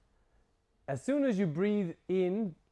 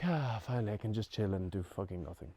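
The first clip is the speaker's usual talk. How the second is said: lower, softer, lazy sigh of relief; 6 semitones lower, 9 dB quieter than usual. Retold or imitated. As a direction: let it all go, finally off duty. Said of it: "The parasympathetic is like".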